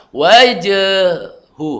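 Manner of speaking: "Wajahu" drawn out very slowly, wrong; wrong long hu. Shown as a demonstration. Teacher shows the mistaken long "Wajahu". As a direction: stretch the last syllable of "Wajahu" as the error.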